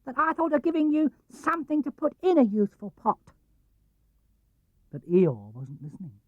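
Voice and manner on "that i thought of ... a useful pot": high, pleased little piglet voice; small and glad, a tiny friend giving a gift; cheerful tiny piglet; happy Piglet. A narrator's reading. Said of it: "said Piglet happily".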